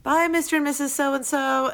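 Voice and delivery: put-on high, sugary babysitter farewell; sweet innocent goodbye. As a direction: chirp it like an angelic babysitter. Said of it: "we would be like".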